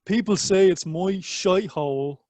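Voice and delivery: Irish Dublin accent, drawn out and lifted. Shown as a demonstration. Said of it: "I'm gonna do it in a Dublin accent".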